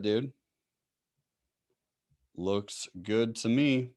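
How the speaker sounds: usual speaking voice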